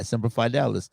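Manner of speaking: normal talking voice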